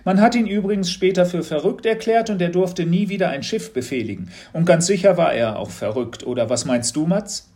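a teacher fishing for a reaction; knowing teacher, probing. A narrator's reading. Teacher tells the legend.

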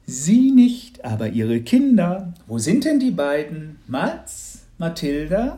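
high, loud, over-the-top saleswoman voice; a disguised neighbour laying on the cheer; hammed-up gushing congratulations; gushing lottery lady. Calling for the children.

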